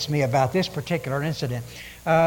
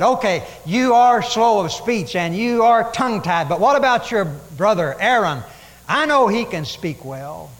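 angry Lord; 4 semitones higher, 6 dB louder than usual. Acted. The angry Lord telling Moses about Aaron, loud and stern. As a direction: scold him but offer a way out. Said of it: "the Lord got angry".

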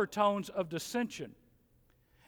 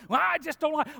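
high, loud whiny complainer; mock griper; 9 semitones higher, 6 dB louder than usual. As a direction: whine like a petty church griper. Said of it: "one person going".

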